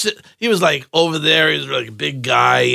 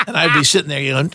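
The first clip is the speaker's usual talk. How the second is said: ordinary talk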